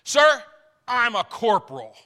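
offended officer drawing himself up; great dignity. Retold or imitated. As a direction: puff up with wounded rank. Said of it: "replied with great dignity".

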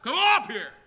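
high, very loud commanding shout. Acted. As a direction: shout it like a summons from heaven. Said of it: "what he's going to shout".

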